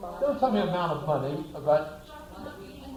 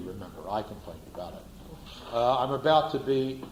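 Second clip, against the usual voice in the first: normal talking voice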